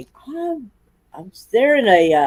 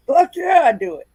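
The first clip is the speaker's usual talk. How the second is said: excited; 8 semitones higher than usual. Retold or imitated. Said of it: "I was like".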